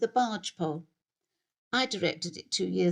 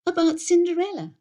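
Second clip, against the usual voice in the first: high, louder prompting voice; vicar's wife prompting; 10 semitones higher, 7 dB louder than usual. Acted. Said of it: "said Eunice".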